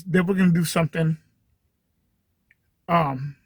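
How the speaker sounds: ordinary talk, nothing marked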